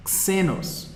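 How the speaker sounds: xenos said slowly, KSE-nos; Greek word xenos. Shown as a demonstration. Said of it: "a palavra xenos".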